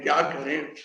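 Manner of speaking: usual speaking voice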